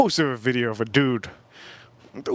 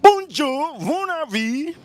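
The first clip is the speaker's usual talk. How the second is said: high, bright mock French greeting; 12 semitones higher, much slower than usual. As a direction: chirp it like a kid trying French. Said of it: "and I said".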